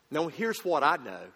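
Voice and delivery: ordinary talk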